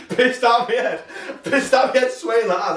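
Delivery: can't get through it for laughing, slow and high. Real, not acted. Laughing through drunk story.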